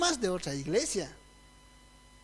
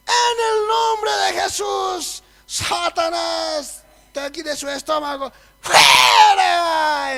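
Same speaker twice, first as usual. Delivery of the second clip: slow, loud, higher exorcism cry; rebuking shout; 7 semitones higher, 9 dB louder, much slower than usual. Retold or imitated. Young preacher rebuking Satan at a sickbed, shouting. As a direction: cast the devil out with full force. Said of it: "Yo digo".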